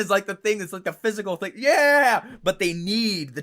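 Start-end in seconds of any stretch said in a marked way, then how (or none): 1.5-2.2 cheer. Retold or imitated. Imitating crowd cheering again.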